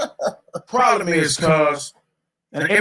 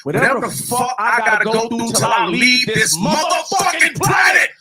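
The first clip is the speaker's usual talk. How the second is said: heated rant climbing higher and higher; yelling; 5 semitones higher than usual. Real, not acted. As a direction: build from raised voice to high-pitched yelling. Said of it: "all that yelling and screaming".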